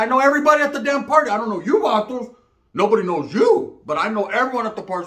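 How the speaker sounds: retold shouting back at the gang members, heated; retold loud comeback.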